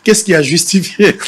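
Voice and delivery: usual speaking voice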